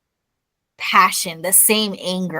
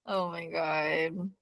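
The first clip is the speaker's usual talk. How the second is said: exasperated sigh; 2 semitones lower, 12 dB quieter, much slower than usual. Real, not acted. Exasperated reaction to co-host.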